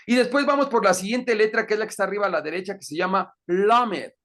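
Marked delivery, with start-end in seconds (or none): none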